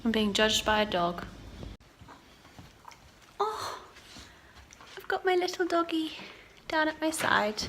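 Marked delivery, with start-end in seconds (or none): 3.4-7.6 high, softened pet voice; tender, raised-pitch doggy talk; cooing over a dog. Real, not acted. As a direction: coo fondly about the little dog. Cooing over the dog beside her.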